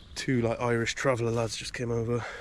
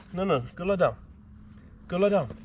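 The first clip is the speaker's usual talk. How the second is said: dog command; 7 semitones higher than usual. Real, not acted.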